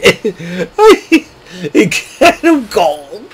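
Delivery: high-pitched giggling words through laughter; can't get the words out for laughing, real; cracking up laughing. Real, not acted. Fan recapping wrestling show breaks into helpless laughter. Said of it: "I'm cracking up".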